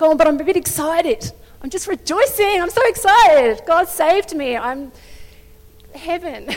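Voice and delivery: high, loud bubbling joy; giddy excitement. Retold or imitated. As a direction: bounce with happy excitement. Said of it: "I have to get a bit embarrassing".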